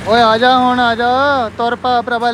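loud call to a friend across the yard; hey, come on, calling a friend over. Real, not acted.

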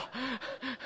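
short laugh. Real, not acted. Laughs at own joke.

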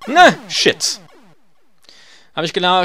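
ordinary delivery

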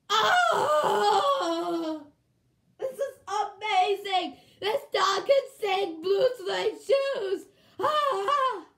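loud, high amazed shouting; slow, drawn-out shriek of amazement; wildly excited. Acted. Reacting to a dog that sings, wildly excited.

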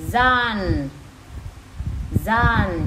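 "Zan" as one joined syllable, z fused onto "on"; linked sound. Shown as a demonstration. Teacher drills the linking for moms, "Zan" as one syllable. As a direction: say "Zan" loudly, twice, with a pause between. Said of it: "Phiên âm nối của nó".

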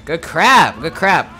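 excited exclaim. Real, not acted. Reacting to kill.

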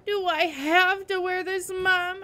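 high, slow, pleading plaint; upset, drawn-out, high-pitched question; trying not to cry. A narrator's reading. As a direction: plead with a parent, on the edge of tears. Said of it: "trying not to cry".

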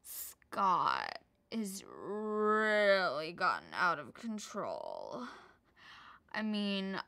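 low, slow, weary voice; Kourtney impression. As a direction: drag it out low and tired. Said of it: "She's like".

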